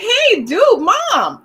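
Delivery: loud, high protest to mom; mock freaking out. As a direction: plead with mom in an acted panic. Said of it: "So I'm freaking out like".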